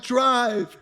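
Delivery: high sharp yelled command; yelling drive at his stalled friend; urgent shout. Retold or imitated. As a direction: bark the word at the driver.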